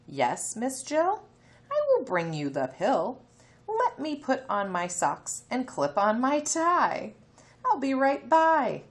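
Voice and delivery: a bright eager neighbor answering the call; Mister Bill on phone. Acted. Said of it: "Mister Bill answers the phone".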